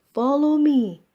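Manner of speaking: loud and high, projected to the back row; proud stage call, big as he can; biggest voice. Acted. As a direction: shout it proudly to the audience. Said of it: "he said in his biggest voice".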